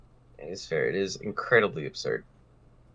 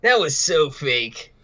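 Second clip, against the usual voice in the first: Scott scoffing; 6 semitones higher, 5 dB louder, much slower than usual. Acted. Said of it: "Scott says".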